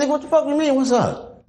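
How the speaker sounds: high, sharp confrontational question; retold challenge. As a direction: fire it back, daring him. Squaring up to new cellmate.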